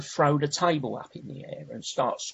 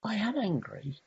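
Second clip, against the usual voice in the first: higher-pitched, mild astonishment at oneself; retold realisation; 9 semitones higher, 3 dB quieter than usual. Speaker recalls first admitting the anger, mildly amazed. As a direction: relive the discovery in a raised voice. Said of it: "And I thought".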